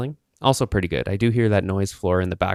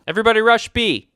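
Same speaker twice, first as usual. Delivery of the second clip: high, loud hammed-up gamer shout; gamer callout; 12 semitones higher, 7 dB louder than usual. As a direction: bark the callout like a hyped shooter player. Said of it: "Do I sound like a gamer right now?".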